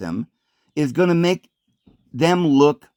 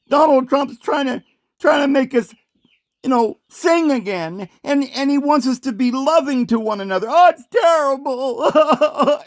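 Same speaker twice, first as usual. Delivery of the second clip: high, sneering mock lady, ending in a laugh; hammed-up imitation of a shocked woman; mocking disgust; 8 semitones higher than usual. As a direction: parody her horror, pitched way up. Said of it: "with this disgust in her voice".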